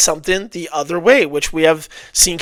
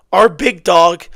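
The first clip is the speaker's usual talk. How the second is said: normal talking voice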